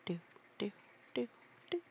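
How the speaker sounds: plain reading voice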